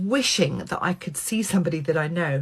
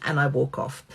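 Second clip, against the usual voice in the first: usual speaking voice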